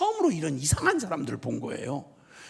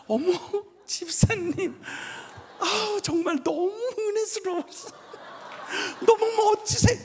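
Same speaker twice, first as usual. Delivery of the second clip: very high, syrupy churchgoer imitation; mock oily gushing. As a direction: gush with exaggerated, cloying admiration. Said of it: "그리고 말을 느끼하게 해". (느끼하게 과장한 교인 말투 흉내)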